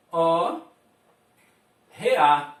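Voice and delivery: "re" and "a" said slowly as separate sounds; sounds spelled out. Shown as a demonstration. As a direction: spell out the sounds of "hora" one by one. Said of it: "O som re".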